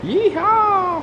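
high loud cowboy holler; cowboy yeehaw. Pretend. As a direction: whoop it like a rodeo cowboy. Echoing cowboy call at big boots.

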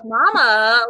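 "Mama" stretched loudly with extra syllables; "Mama" broken up and drawn out. Retold or imitated. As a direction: imitate a Southern child drawling "Mama". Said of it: "he has these extra syllables".

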